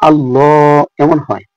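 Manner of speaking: slow "আল্লাহ" with a thick, full l; heavy Allah demo. Shown as a demonstration. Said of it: "আল্লাহ হয় না". (মোটা করে ধীরে "আল্লাহ")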